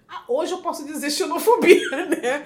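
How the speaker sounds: loud laughter breaking through; laughing. Real, not acted. Guest laughing as she names xenophobia. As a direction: laugh off a painful memory.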